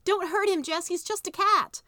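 high, loud desperate plea; pleading girl. Acted. Maybelle pleads with Jess over the cat. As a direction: beg him to spare the cat.

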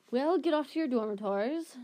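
lightly voiced stern prefect; a hint of a bossy older brother giving orders; stern Percy. A narrator's reading. Percy ordering the boys off to bed, mildly stern. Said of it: "said Percy sternly".

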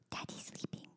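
put-on hushed whisper as a small child; very soft, voiceless child's hush; imitating whispering child. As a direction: whisper like a toddler shushing the room. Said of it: "And Lila goes".

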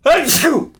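loud real sneeze; sneezing. Real, not acted. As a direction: sneeze out hard. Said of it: "I'm going to sneeze".

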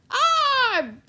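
high, loud "arr" roar; pirate yell. A narrator's reading. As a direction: yell like Blackbeard from behind. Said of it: "Blackbeard yelled somewhere behind us".

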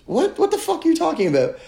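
hammed-up incredulous squeal; squeaky disbelief. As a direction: shriek it in comic disbelief. Climax of retold argument.

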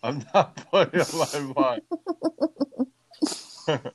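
laughing through the words; giggling protest. Real, not acted. Laughing denial.

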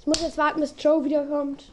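ordinary talk

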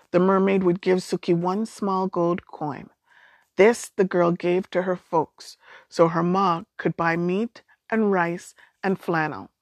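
ordinary delivery, nothing marked

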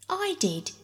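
screamed. A narrator's reading. Phyllis screams back at Peter in the nest quarrel. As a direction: shriek it back high, childish. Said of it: "screamed Phyllis".

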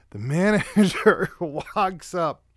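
slow, laughing through the words. Real, not acted. Telling about the manager while laughing.

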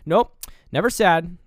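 acted-out stubborn rebuttal; emphatic denial. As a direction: shoot the idea down firmly. Border agent flatly contradicts the visitor.

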